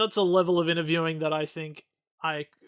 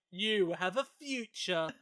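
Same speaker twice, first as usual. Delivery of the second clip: slow, put-on grand voice; mock pompous compliment; 2 semitones higher, 3 dB quieter, much slower than usual. Imagined courtier praising her dress, slow and grand. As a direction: lay the praise on slowly and grandly.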